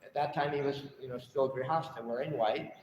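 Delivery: usual speaking voice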